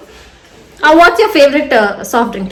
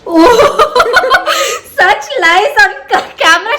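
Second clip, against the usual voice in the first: laughing; 6 semitones higher than usual. Real, not acted. Laughing at friend's fake compliment.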